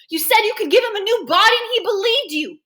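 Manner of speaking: high, loud reproach; anguished accusation. A narrator's reading. Boy blames the doctor for Kevin's death, anguished. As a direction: hurl it as an accusation.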